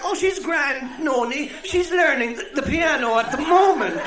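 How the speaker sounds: put-on high, slow calling old woman; old Cork woman. Old woman calling to her friend over the partition, high and slow. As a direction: call over the toilet partition. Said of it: "the other one shouts back".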